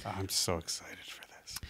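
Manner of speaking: very soft, whispered aside; excited whisper. Real, not acted. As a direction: whisper your anticipation without interrupting. Excited aside during the story.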